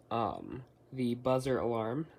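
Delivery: ordinary delivery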